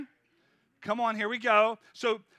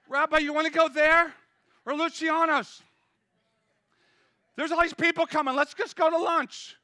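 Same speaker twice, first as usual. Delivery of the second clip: eager pleading; 5 semitones higher, 5 dB louder than usual. Acted. Disciples urging Jesus to lunch.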